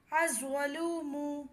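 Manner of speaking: الظلوم said slowly as "az-zalum", lam silent. Shown as a demonstration. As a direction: draw out الظلوم, zoe doubled. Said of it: "لام نہیں پڑھا جائے گا".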